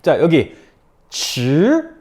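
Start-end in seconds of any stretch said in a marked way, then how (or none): 1.1-2.0 쯔 climbing to high; second tone. Shown as a demonstration. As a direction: lift 쯔 like a question. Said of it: "이성이죠?".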